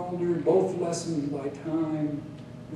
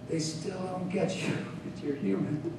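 softer voice breaking into a chuckle; laughing retort; 7 dB quieter than usual. A narrator's reading. Poet teasing his old mentor as they reminisce, laughing. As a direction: shoot back through a soft laugh. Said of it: "Laughing together, I shot back".

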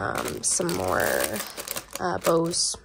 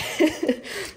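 breathy laugh; 9 semitones higher than usual. Real, not acted. Laughing at own joke.

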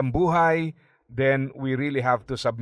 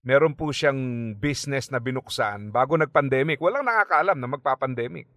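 normal talking voice

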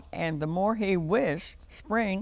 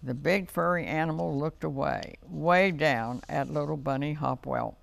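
ordinary delivery

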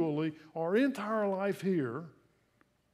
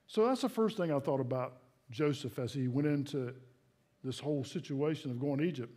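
usual speaking voice

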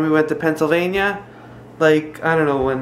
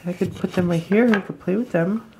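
ordinary talk

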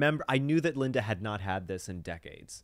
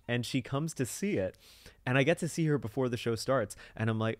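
ordinary talk

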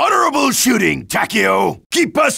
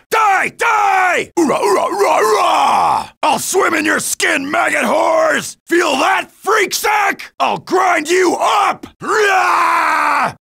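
battle rage; 7 semitones higher, slower than usual. Acted. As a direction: roar it like a soldier gone wild in the fight. Berserk battle cries in combat.

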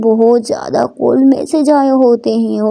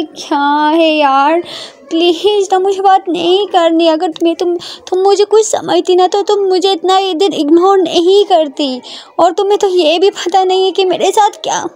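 higher-pitched, plaintive reproach; hurt Prita; 6 semitones higher than usual. Acted. Prita accuses Ishani of ignoring her, hurt.

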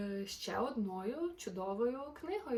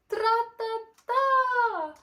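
high, loud, slow drumming tra-ta-ta; loud slow rat-a-tat; knocking sound; 13 semitones higher, 12 dB louder, much slower than usual. Acted. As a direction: imitate a woodpecker hammering the branch. Knocking grows louder.

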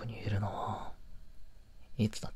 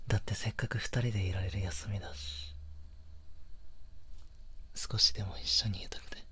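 put-on soft close whisper to his girlfriend; tender boyfriend whisper, almost no voice; intimate whisper; 2 semitones lower, 6 dB quieter than usual. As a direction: breathe the words right by her ear. Whispered boyfriend ASMR talk.

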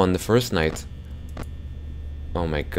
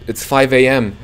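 despairing cry; 4 semitones higher, 5 dB louder than usual. Real, not acted. Power runs out at five.

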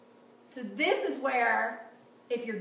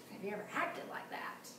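whisper; 3 semitones lower, 10 dB quieter than usual. Real, not acted. Pointed question to the congregation.

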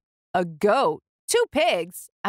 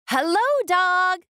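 much higher, bright little duck greeting; cheerful duck voice; 11 semitones higher than usual. A narrator's reading. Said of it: "said Duck".

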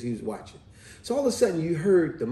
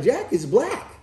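put-on higher, louder kid voice; raised mock-child insistence; little boy; 6 semitones higher, 4 dB louder than usual. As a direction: imitate a boy correcting himself. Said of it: "He said".

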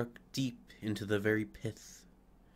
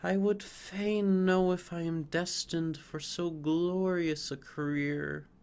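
put-on higher, joyful young fir; rejoicing cry; 7 semitones higher than usual. Fir dreaming of its own glorious future. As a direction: cry out with hopeful delight. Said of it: "cried the tree, rejoicing".

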